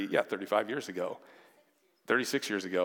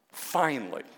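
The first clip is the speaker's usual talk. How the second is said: ordinary delivery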